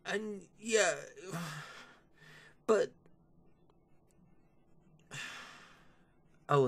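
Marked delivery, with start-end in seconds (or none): 1.0-1.8 a long tired sigh; weary sigh. Real, not acted. Vlogger exasperated by the new series, sighing. 6.5-6.6 sigh and oh. Real, not acted. Vlogger gearing up to mock the screwdriver, sighing. Exasperated before next complaint.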